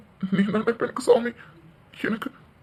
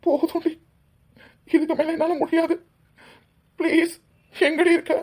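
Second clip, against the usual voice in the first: much higher, tearful pleading with breaking sobs; crying plea; 7 semitones higher than usual. Acted. Husband crying out for his vanished wife, sobbing. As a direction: beg her to come back, voice cracking with tears.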